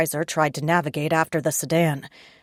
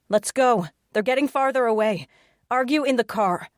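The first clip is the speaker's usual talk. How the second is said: urgent woman's voice; 7 semitones higher than usual. Acted. Josie urging from car window.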